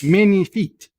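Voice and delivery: put-on French pronunciation; French accent. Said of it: "as they say in French".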